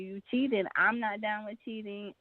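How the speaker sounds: usual speaking voice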